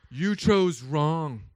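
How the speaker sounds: slow, loud, low self-righteous scolding; mock preachy condemnation. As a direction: thunder it like a judgmental church lady. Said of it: "That's exactly what I didn't say".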